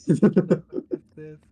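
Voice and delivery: loud, stunned exclamation; real shock at what he sees; shocked outburst. Real, not acted. Shocked by a Facebook video.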